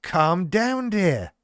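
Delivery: patronizing catchphrase. Retold or imitated. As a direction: talk down to her like a smug ad man. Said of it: "emerge from nowhere and with the line".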